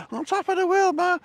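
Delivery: high, loud mock gangster cry; Cagney quote. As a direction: belt it out like the film gangster on top. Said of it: "glad somebody got the reference".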